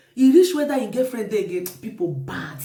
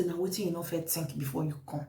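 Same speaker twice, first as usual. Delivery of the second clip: low, very soft reproachful question; quiet rebuke; 4 semitones lower, 12 dB quieter than usual. Retold or imitated. Host questioning the visitor who came accusing, quiet rebuke. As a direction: ask it under control, low and soft.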